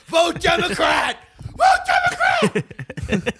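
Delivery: very high, slow brainwashed yell; brainwashed shout. Retold or imitated. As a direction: scream it like a programmed zombie. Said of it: "activated M K Ultra style".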